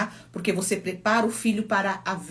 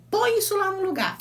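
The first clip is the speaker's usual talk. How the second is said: mother scolding; 12 semitones higher than usual. Retold or imitated. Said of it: "e ela falou".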